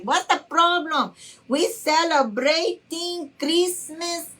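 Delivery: put-on high, insistent Honduran-accented mom; mother's Honduran accent. Mom urges the family to dance at Christmas, lively Honduran accent. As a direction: imitate a Honduran mother rallying everyone in her accent. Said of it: "My mom was like".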